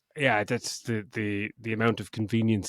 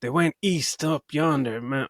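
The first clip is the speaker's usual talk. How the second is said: high, loud hammed-up Wild West drawl; mock an overplayed Wild West local; Wild West accent; 5 semitones higher, 4 dB louder than usual. Film fan mocking overacted townsfolk, hammed-up Wild West accent. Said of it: "acting like it's the fucking Wild West".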